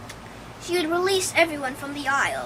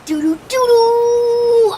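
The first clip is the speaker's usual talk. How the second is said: entrance fanfare; 7 semitones higher, 9 dB louder, slower than usual. Pretend. Uma's big entrance.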